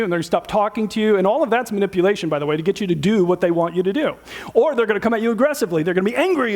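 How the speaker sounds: ordinary delivery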